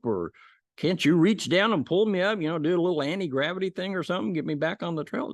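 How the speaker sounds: high, pleading protest; a lightly acted panicked climber; frantic pleading. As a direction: beg God not to make him let go. Man argues with God.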